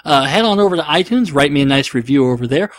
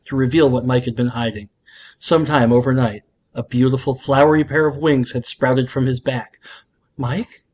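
podcast host narrating a fan story; story narration; 3 semitones lower than usual. A narrator's reading. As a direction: read the fanfic like a bedtime tale. Reads fanfic aloud on show.

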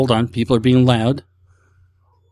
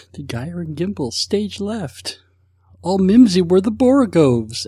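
Snagglepuss impression; 6 semitones higher, slower than usual. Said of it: "for Snagglepuss".

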